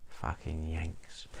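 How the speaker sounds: low, slow muttered aside under the breath. Acted. Complaining about Americans, slow, soft, low muttered aside.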